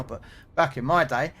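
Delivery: usual speaking voice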